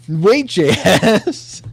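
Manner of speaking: slow, high, drawn-out wait; drawn-out surprise. Retold or imitated. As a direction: stretch it out in surprise. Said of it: "and now I'm like".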